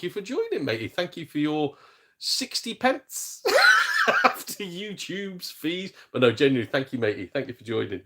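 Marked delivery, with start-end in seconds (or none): 2.2-5.9 much higher, slowed, breaking into laughter over the sixty pence; laughing sarcasm. Real, not acted. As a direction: laugh through the sarcastic thanks. Thanking a member for tiny payout.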